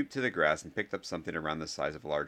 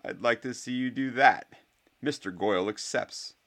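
higher, projected challenge; loud; 5 semitones higher, 5 dB louder than usual. A narrator's reading. Draco accepts on Goyle's behalf, loudly. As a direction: announce it scornfully to the crowd. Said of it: "said Draco loudly".

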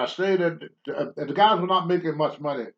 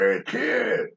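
wrestler calling out; 4 semitones lower than usual. Retold or imitated. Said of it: "that's just how the wrestler talks to you".

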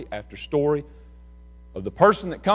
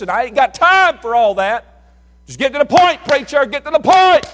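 higher, louder, shouting demand to hurry; impatient shout; 9 semitones higher, 10 dB louder than usual. Retold or imitated. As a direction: yell it at the preacher, fed up. Impatient churchgoer shouting at preacher.

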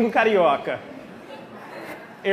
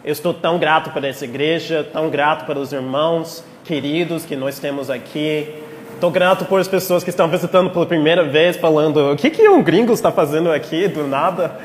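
prayer; 4 semitones lower than usual. Acted. Leads a prayer.

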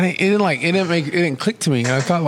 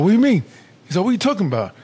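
acted bafflement; 6 semitones higher, much faster than usual. Retold coworker argument over the label, acted bafflement. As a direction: throw it back as a mock challenge.

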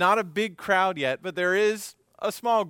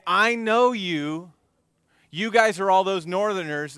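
high, loud, pointed accusing; loud accusation; 5 semitones higher, 8 dB louder than usual. Acted. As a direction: call him out in front of everyone. Crowd accusing Peter, acted.

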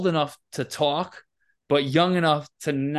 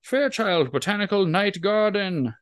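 higher, theatrical tree greeter; lightly hammed welcoming tree; talking tree voice; 6 semitones higher than usual. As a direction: welcome everyone like a grand holographic tree. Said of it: "So he's like this".

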